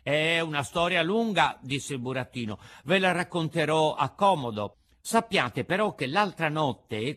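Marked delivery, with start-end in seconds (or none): 0.0-1.6 higher, louder little puppet. A narrator's reading. Puppet answers his two companions, high and loud. 2.8-7.1 higher, louder little puppet. A narrator's reading. Puppet answers his two companions, high and loud.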